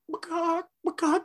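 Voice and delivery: man stuck as a chicken asking for food; chicken noises. Retold or imitated. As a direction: cluck like a chicken trying to order. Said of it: "he's all like".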